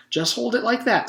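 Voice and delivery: high, sweet dentist voice; a sugary, sinister dentist. A narrator's reading. Dentist voice in story.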